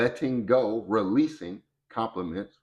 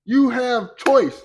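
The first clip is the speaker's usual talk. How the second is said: emphatic call; 12 semitones higher, 7 dB louder, slower than usual. Real, not acted. Urging listeners to use choice.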